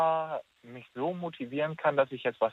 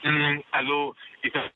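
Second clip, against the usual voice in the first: bad phone line. Real, not acted. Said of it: "ich höre dich ganz schlecht".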